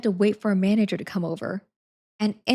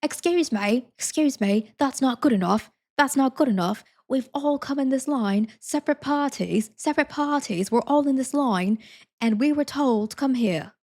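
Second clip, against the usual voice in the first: higher-pitched, hammed-up British complaint; terrible British accent; 5 semitones higher than usual. British guy in line objects to the single-rider ruling, terrible British accent. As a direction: do an indignant, badly faked British accent. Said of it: "a terrible British accent".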